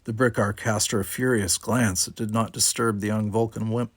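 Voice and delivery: plain reading voice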